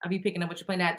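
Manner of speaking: ordinary talk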